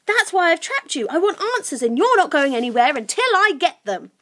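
put-on high-pitched, firm girl; insistent Lucy. Lucy demanding answers from trapped creakers, insistent. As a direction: lay down terms in a high voice.

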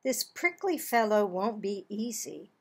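plain reading voice

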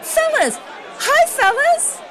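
high, loud call across the floor. Acted. Reporter hails the rhinos across the trading floor, calling out high. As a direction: hail them over the crowd.

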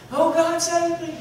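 high panicked holler for help. Retold or imitated. Man sliding off a wet roof calls to God, high panicked holler. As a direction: yell out as he slides off the roof. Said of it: "he hollers".

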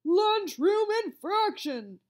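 slow, loud bellowing lunch monitor; strict lunch monitor bellowing at a kid. A narrator's reading. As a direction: bellow it like a drill sergeant. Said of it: "Missus Buns would bellow".

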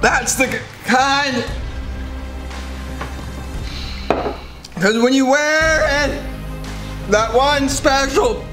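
reciting through a burning mouth; strained, slow, pained recital; mouth burning from spicy food. Real, not acted. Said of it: "recite SpongeBob's striped sweater song".

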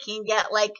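normal talking voice

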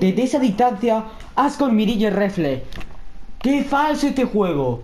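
high, slow, stretched cry of disbelief; outraged disbelief. Real, not acted. Gamer protesting to his friend about an unfair kill, high, drawn-out outrage. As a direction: protest the cheap kill like it's a scandal.

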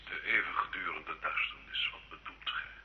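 the threat lands and chills him; fearful whisper. Acted. Gilles to the inquisitor about eternal darkness, a frightened whisper.

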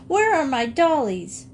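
put-on high, scolding child's voice; little girl crying out. A narrator's reading. Little girl scolding Jinx while slapping him. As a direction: she slaps the cat as she scolds. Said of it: "she cried".

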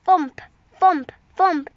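loud monster footsteps; loud thumps. Acted. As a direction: stamp the words out like heavy footsteps.

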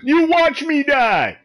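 high, drawn-out complaint; indignant accusation. Real, not acted. Player accusing teammates who drove off, indignant.

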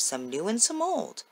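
plain reading voice

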